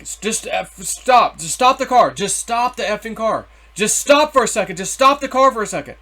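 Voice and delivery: loud, high retold yelling at a driver; exasperated re-enacted shout; upset demand. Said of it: "now I'm starting to get upset".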